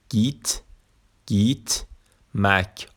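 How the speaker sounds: "گیت" said geet with long rising ee, pause before "مک"; dotted i as "ee". Shown as a demonstration. Teacher showing learners the dotted i, careful ee in گیت. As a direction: break گیت مک into syllables, drawing out the ee.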